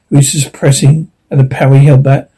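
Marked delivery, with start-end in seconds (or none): none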